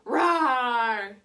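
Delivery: loud, long playful roar; lion roar. Shown as a demonstration. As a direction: give a full lion roar with the class. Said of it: "Roar like a lion. Let's hear it".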